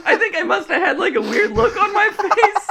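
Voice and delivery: very high voice through laughter; cracking up. Real, not acted. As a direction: try to keep telling it while laughing. Retelling while cracking up.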